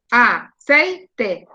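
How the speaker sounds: "Aceite" in three separate beats, CEI strongest; broken into syllables. Shown as a demonstration. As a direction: pause between A, CEI and TE in "Aceite". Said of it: "Sei es la sílaba tónica".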